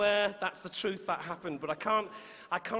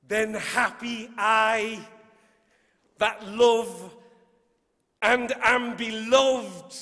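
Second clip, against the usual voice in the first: slow, building recitation, louder on the battle lines; poem reading; 6 dB louder, much slower than usual. Shown as a demonstration. Poet reciting Shakespeare's sonnet to the audience, building. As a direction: savour each phrase, then end very slowly. Reads Sonnet twenty-five aloud.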